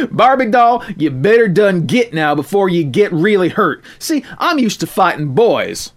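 put-on high, loud scoffing farm girl; cocky country girl; tough tomboy taunt. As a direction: laugh off the threat. Tomboy taunting bully back.

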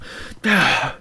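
long frustrated exhale; heavy calming sigh. Acted. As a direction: blow out the anger. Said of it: "exhaled a frustrated breath".

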